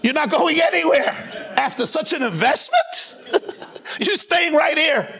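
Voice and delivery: high, laughing boss voice, teasing the man who came to quit; a boss laughing as he refuses a resignation; amused boss. Acted. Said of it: "He says".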